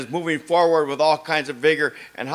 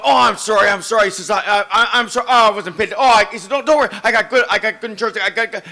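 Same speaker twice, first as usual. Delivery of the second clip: flustered, stammering, a bit higher; tripping over his words, higher and louder; nervous stammering apology; 5 semitones higher, 4 dB louder than usual. Retold or imitated. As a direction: retell the other driver's panicky apology. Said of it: "he was just so terribly nervous".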